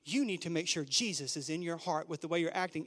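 low, soft, severe scolding; hushed stern Sunday school teacher; stern teacher warning. Retold or imitated. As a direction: lean in and warn him gravely. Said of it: "she looks at me with serious eyes".

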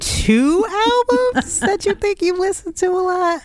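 high-pitched, amused question; laughing question. Real, not acted. Putting the album question to a co-host, laughing. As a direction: ask it through laughter, pitched right up.

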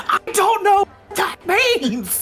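high, drawn-out wail of confusion; hammed-up despair; mock desperation. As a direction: plead bafflement at a high pitch, half laughing. Grabs Rob's shoulders, baffled, laughing.

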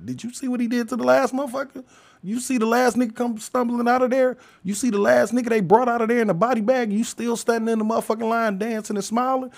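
high-pitched, disbelieving questions in a run; incredulous questioning. Acted. Asking someone waiting in line, incredulous.

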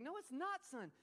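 calm his fear; reassuring father. Retold or imitated. Father reassuring his frightened son, insistent. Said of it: "he's like".

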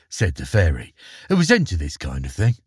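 calm storytelling voice; story narration. Acted. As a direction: read it like a bedtime fairy tale. Narration resumes.

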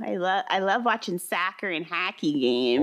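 hammed-up Minnesotan vowels; Minnesota accent. Shown as a demonstration. Co-host joking about home-state hobbies, Minnesota accent. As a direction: lean into a jokey Minnesota accent. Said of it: "my Minnesota accent".

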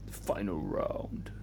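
whisper echo. Pretend. Echoing ominously.

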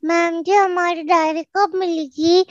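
put-on high little girl voice. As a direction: ask like a small schoolgirl. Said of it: "I again asked".

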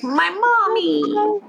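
put-on high, drawn-out babyish wail; pleading little kitten. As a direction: call out slowly like a lost little one. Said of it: "performance if you're gonna be like".

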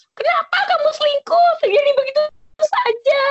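high-pitched mock sinetron outburst; soap-opera melodrama. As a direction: act it like a betrayed soap-opera lover. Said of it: "kayak di sinetron-sinetron gitu".

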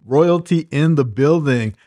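punchy, rhythmic show-opener delivery; podcast host intro. Acted. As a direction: announce the guest like royalty.

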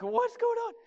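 very high-pitched, startled question; mock shock. Pet owner hears the cat talk, mock shock. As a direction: squeal it in put-on disbelief.

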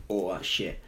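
usual speaking voice

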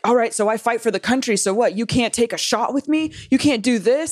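lightly voiced pushy guy; pushy Marine. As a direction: egg her on like a macho Marine. Marines pressuring drinks.

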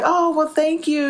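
high, drawn-out polite thank-you; gracious thanks. Retold or imitated. Mother thanking a lady for praising her daughter, acted sweet gratitude.

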